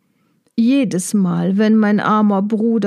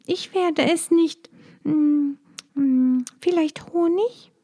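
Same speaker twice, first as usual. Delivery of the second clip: little bee voice; 7 semitones higher, 3 dB quieter than usual. Acted. Maya guesses timidly.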